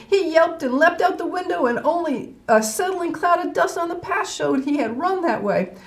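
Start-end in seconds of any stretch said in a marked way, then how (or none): none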